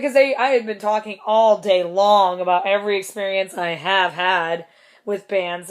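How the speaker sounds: ordinary talk, nothing marked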